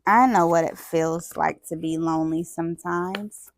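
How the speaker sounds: usual speaking voice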